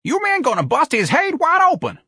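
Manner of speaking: very high worker's yell; shrieked scolding; alarmed cry. A narrator's reading. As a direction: cry out a frantic warning. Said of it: "A cry arose from the other workers".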